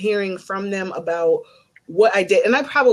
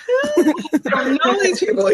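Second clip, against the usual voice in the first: high squeaky huh, then a laughing protest; high, laughing bewilderment; shocked disbelief; 10 semitones higher, 3 dB louder than usual. Retold or imitated. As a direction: act baffled and amused at the invitation. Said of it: "I'm like".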